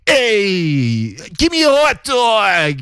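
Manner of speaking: put-on high, slow shouted New York accent. Ordering a hot dog in New York, shouted in a New York accent. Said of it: "in that accent".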